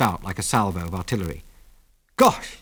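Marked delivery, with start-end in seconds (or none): 2.2-2.6 high exclamation of awe; awestruck. A narrator's reading. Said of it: "he murmured in an awestruck voice".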